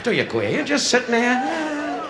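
ordinary talk

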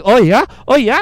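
loud, high, challenging retort; mock upset. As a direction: imitate an offended rival squaring up. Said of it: "the guy next to me got upset".

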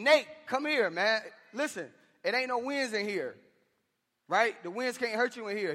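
high, loud plea to a son; screaming. Retold or imitated. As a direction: shout him in out of the storm. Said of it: "and I'm screaming".

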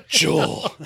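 loud, drawn-out mock gasping voice; out-of-breath impression. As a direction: heave the name out as if winded. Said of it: "sounds like he's out of breath".

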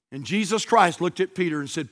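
ordinary delivery

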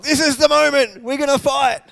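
loud, high eager cry; itching for a fight; eager disciples. Retold or imitated. Disciple calling to Jesus, loud and eager. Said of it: "He's like".

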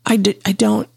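stammering with a dry mouth. A narrator's reading. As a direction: struggle to speak, mouth parched. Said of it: "trying to work up some saliva".

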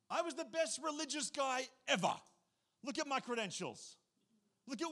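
imitating boastful Paul. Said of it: "Paul is like".

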